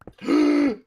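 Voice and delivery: loud high gasp of pain; sharp gasp. Real, not acted. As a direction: gasp as you get hit. Hit by a player.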